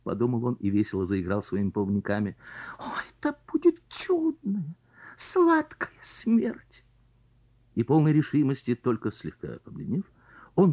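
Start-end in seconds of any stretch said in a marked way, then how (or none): 2.8-6.8 dreamy whisper. Acted. Carp dreams of death.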